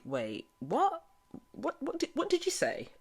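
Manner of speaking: high, shocked "what did you say"; mock shock. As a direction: pitch it up in disbelief, imitating. Retold shocked reaction.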